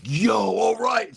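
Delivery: stoked yell. Retold or imitated. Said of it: "They're like".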